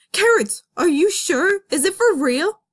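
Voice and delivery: can't believe the news; disbelieving joy. Acted. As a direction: fox hearing he'll be a father, thrilled. Nick reacting to pregnancy.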